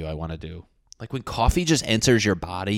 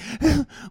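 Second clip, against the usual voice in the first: mock gasp. Caffeine leaves, crash.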